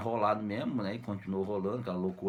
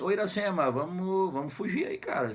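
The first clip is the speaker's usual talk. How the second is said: higher-pitched mock urging; urgent coaxing; 8 semitones higher than usual. Brother urges Iracema to flee, higher and urgent. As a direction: call out to her, lightly acted.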